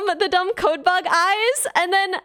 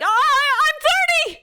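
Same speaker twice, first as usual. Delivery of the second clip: mock panicked outburst; 9 semitones higher than usual. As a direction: blurt it out high, as if caught out. Acts out panicked reply to fan.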